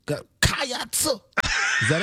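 "Cats" stretched high and drawn out like a preacher; preacher-style cheer. Acted. Co-host trying the Kentucky cheer, preacher-like. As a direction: stretch "Cats" into a sermon-like wail.

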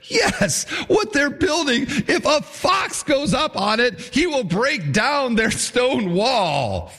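high, sneering taunt, yelled out; mocking jeer. Tobiah's jeer read aloud.

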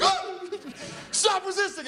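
high, hammed-up cry of protest; mock protest. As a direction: squeal like someone being arrested. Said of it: "I'd be like".

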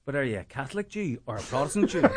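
loud, breaking into laughter at the punchline; can't hold back the laugh as he delivers the punchline; laughing through punchline. Real, not acted. Punchline of his own joke.

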